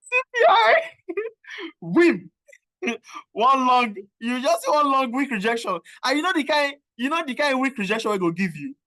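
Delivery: crying with laughter. Real, not acted. Laughing to tears at market.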